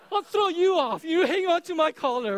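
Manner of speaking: high, loud playful romp; playful bull-ride dad. Retold or imitated. Dad offering his son a home bull ride, high loud playful romp. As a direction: play the bucking bull for your kid.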